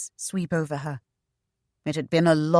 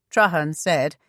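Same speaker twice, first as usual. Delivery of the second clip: plain reading voice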